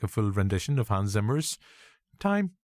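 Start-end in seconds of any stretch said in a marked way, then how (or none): 2.2-2.5 "Time" sung out much higher; sudden high pitch. Pretend. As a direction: lift "Time" way up high like a film score note. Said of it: "I don't know why I went high-pitched".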